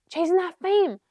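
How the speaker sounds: loud, bright eureka; triumphant remembering. Real, not acted. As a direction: shout it in delight at finally getting it. Finally remembering the lyric.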